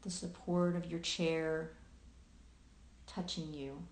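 unhurried meditation guiding with long pauses; guided meditation. Acted. Host guiding listeners into present awareness, calm meditation voice with long pauses. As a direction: lead listeners gently through their senses, leaving long silences.

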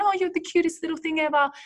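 put-on high, fast cooing baby talk; cute baby voice. Cooing at a baby while grabbing its cheeks. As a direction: coo at the baby in a wee little voice. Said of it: "we all start talking in this cute, wee little voice".

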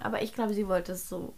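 normal talking voice

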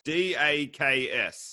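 slow, separated letters; spelling it out. Shown as a demonstration. Host spelling new word for guest, slow. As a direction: give each letter its own space.

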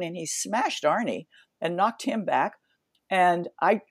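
ordinary delivery

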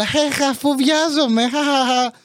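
high-pitched fake laugh; joking it off. Mother covering embarrassment, forced joking laugh. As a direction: imitate mum pretending it was a joke. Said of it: "Το ρίξε στην πλάκα και καλά".

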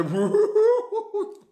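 high burst of laughter; big laugh. Real, not acted. Streamer cracking up at a chat message.

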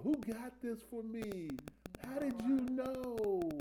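high, slow mock astonishment; amazed gift receiver. Preacher acting someone given an anonymous gift, mock amazed. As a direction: play-act delighted disbelief, stretched out and high-pitched.

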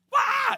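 loud high wail, acted; mock panicked scream. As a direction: burst out like a boat full of screaming men. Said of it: "Everybody's crying and screaming".

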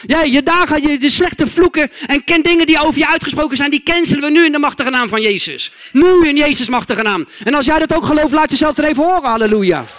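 loud, forceful declaration over the congregation; preaching proclamation. Real, not acted. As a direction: proclaim it with full-force authority. (krachtig proclamerende prediking)

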